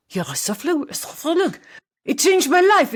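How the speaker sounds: high, loud, hammed-up French accent; terrible French accent. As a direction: gush like an excited Frenchwoman in a bad French accent. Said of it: "that was a terrible French accent".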